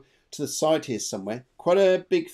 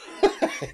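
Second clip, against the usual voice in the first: high giggle. Real, not acted. Joke about tipsy spirits.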